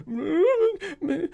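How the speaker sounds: high, hammed-up wail calling the dog's name with a laugh breaking through; mock wailing plea. Grieving owner begs his dead dog to wake up, hammed-up high wail. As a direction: cry out to wake her, way up high and overdone. Crying over dead dogs.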